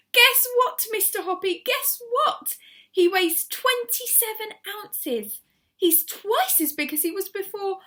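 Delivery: high, loud delighted cry; excited shouting. A narrator's reading. Missus Silver shouting up to Mister Hoppy, overjoyed. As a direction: burst with joy. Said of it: "waving it above her head and shouting".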